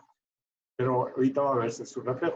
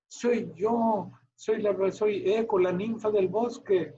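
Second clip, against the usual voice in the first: higher, gentle nymph voice; 6 semitones higher, slower than usual. Acted. Teacher voicing Eco to the class, high and gentle. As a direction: speak up high as the shy nymph. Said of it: "Eco le dijo".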